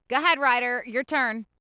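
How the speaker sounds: normal talking voice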